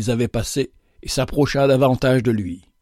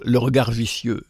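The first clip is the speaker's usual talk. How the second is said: plain reading voice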